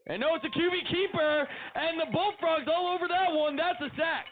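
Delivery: rising excitement as the defense gets there; excited sack call. Real, not acted. Sack called on live play.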